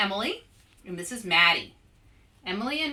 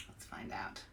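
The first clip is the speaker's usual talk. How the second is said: breathy secret whisper; 13 semitones lower, 18 dB quieter than usual. Real, not acted. Author to young viewers, whispering before the reveal. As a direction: lean in and whisper it. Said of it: "whispered to young viewers".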